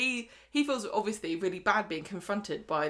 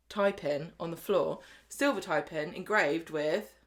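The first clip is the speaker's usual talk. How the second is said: usual speaking voice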